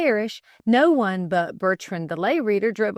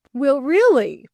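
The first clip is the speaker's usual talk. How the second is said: puzzled; 6 semitones higher, 7 dB louder, slower than usual. Acted. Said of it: "puzzled the lay reader".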